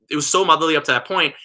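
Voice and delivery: ordinary talk, nothing marked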